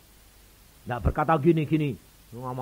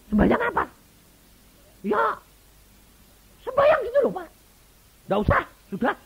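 high, loud comic voices; incredulous exchange; 10 dB louder than usual. Retold or imitated. Husband asking the pastor to pray, high loud comic exchange. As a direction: play the baffled back-and-forth loudly.

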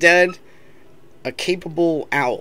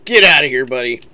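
loud shout; 9 dB louder than usual. Real, not acted. Shooing off an enemy.

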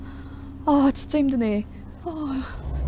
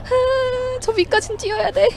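scared horror game streamer; 12 semitones higher, slower than usual. Real, not acted. Running from zombie.

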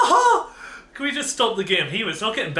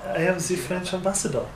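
quick, softer put-on French accent; mock French accent; 6 dB quieter, faster than usual. Ambassador answering who she is, mock French accent. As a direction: introduce yourself in a hammy French accent. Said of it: "make her sound like Marie Antoinette".

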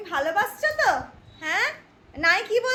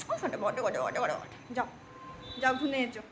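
normal talking voice

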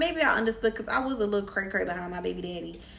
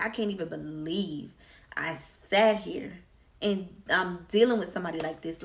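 ordinary delivery

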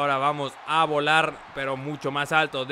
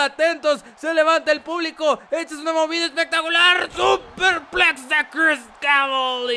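wrestling commentator; 8 semitones higher, 5 dB louder than usual. Acted. Hyped wrestling play-by-play.

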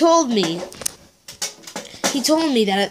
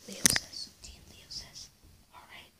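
whispering; 5 semitones higher, 22 dB quieter than usual. Acted. Whispered prompts.

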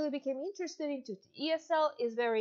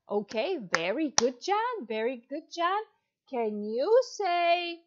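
put-on louder, bright teacher voice for a young child; demo kids' lesson; 4 dB louder than usual. Shown as a demonstration. Teacher to little John in China, upbeat and encouraging. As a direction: cheer on a five-year-old online student. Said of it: "we're gonna go on to our demo lesson".

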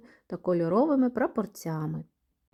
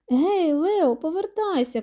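high, raised calling voice; 8 semitones higher, 4 dB louder than usual. A narrator's reading. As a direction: call a friend back from afar. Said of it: "кличе Теса". (гукаючи високим голосом)

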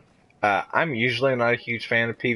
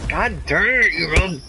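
frustrated outburst; 8 semitones higher, 3 dB louder than usual. Real, not acted. Getting ganked in game.

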